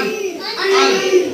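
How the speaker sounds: अन sung out high through the nose; nasal sound demo. Shown as a demonstration. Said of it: "तो सुद्धा नाकातूनच उच्चार होतो".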